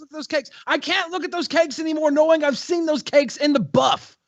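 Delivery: high mock lament.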